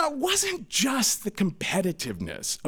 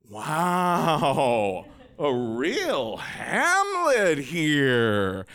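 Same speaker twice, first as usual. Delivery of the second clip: mocking older brother; much slower than usual. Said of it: "He said".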